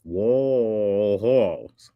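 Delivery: stretched way out, very slowly; slow-motion, over-stretched delivery; exaggerated slow repeat. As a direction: draw out every syllable for someone not understanding. Drawn out for baffled listener.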